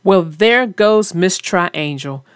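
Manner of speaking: ordinary delivery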